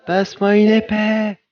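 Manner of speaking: voix aiguë de personnage; petite voix aiguë. Pretend. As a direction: demande l'épée d'une voix de fausset. Demande une épée, voix aiguë. (réclame une épée d'une petite voix aiguë)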